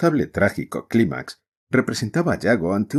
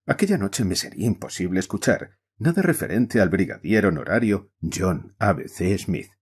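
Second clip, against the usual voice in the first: usual speaking voice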